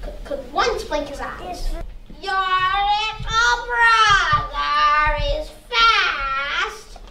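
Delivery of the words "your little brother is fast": very slow, drawn-out spooky sprite; water sprite voice. Acted. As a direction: stretch every word like a lake spirit.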